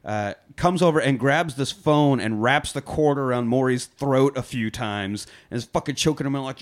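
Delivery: normal talking voice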